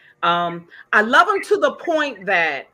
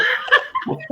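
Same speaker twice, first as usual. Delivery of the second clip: laugh. Real, not acted. Reacting to rated R request.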